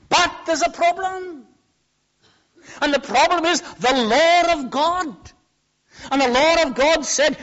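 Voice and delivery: high, loud, emphatic pulpit delivery; full-force preaching. Acted. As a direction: drive it home to the congregation.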